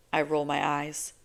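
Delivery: ordinary delivery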